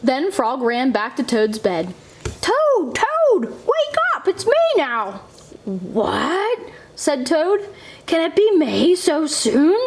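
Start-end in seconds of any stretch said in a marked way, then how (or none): 2.4-5.2 much higher, slow, thrilled wake-up call; excited Frog. A narrator's reading. Frog wakes Toad. 5.6-9.8 higher, puzzled toad; surprised Toad. A narrator's reading.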